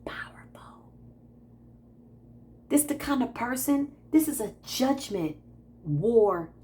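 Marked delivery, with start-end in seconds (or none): 0.1-0.9 hushed, much softer, in awe; awed whisper. Retold or imitated. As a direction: whisper it as if in reverence. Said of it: "I'm like".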